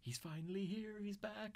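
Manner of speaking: a high, unhinged thrilled whisper-tone; creepy Henry voice. Retold or imitated. Henry seeing the balloon, high creepy delight. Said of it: "He's like".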